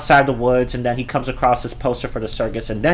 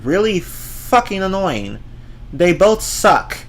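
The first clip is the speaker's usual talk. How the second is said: disgusted; 6 semitones higher, slower than usual. Real, not acted. Ranting about annoying characters.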